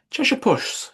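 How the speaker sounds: high, hesitant address to the cat; a touch of shy caution; timid. A narrator's reading. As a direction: call the cat timidly, unsure of the name. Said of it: "with rather timidly".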